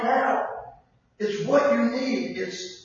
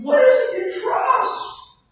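higher, slow challenge to the crowd; challenging question; 13 semitones higher, 6 dB louder, slower than usual. Real, not acted. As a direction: press them hard on what they trust. Preacher challenging the congregation.

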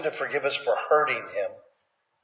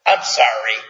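flippant sorry; 4 semitones lower, 4 dB louder than usual. Retold or imitated. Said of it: "not flippant".

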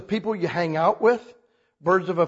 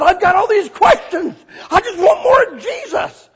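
loud, high, near-tearful plea; fervent cry; 11 semitones higher, 7 dB louder than usual. Retold or imitated. New convert pleading with the pastor's wife, loud and tearful. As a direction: cry out hungry for more.

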